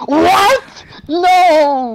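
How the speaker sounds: high, loud disbelieving what and no; shocked yell. Real, not acted. Streamer seeing himself targeted, yelling in shock. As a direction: cry out in disbelief. Reacting to his own death.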